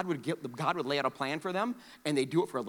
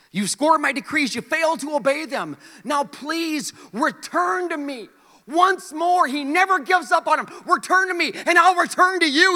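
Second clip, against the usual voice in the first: loud, high, impassioned preaching; pleading sermon shout; preaching at full force; 12 semitones higher, 10 dB louder than usual. Acted. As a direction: cry out God's plea to the people.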